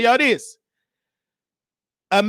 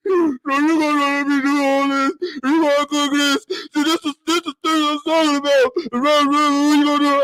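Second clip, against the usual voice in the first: mock blubbering, a bit higher; friend crying; 3 semitones higher than usual. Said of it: "sound like a bear crying".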